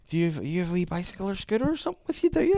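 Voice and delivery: lightly put-on old woman leaning in; a hint of a chatty old woman in a cafe; old woman asking. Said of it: "this old woman was like".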